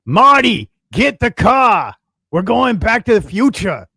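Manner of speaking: lightly voiced movie scientist urging Marty; hammed-up Doc Brown. Host quoting the movie to his co-host, hammed-up Doc Brown. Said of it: "I don't know what that accent is".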